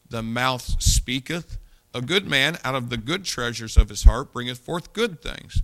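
measured, solemn reading of the verses; scripture reading. Acted. Preacher reads scripture aloud solemnly. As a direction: read the Bible passage with weight to the congregation.

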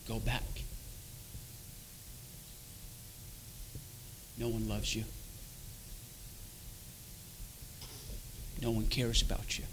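low, hushed tormenting voice in the head. Retold or imitated. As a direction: quietly voice a taunting inner demon, slightly held back. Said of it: "this voice was saying".

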